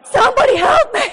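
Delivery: high, loud hammed-up cry for help; mock scream. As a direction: scream for help as the cake drips everywhere. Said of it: "And I start screaming".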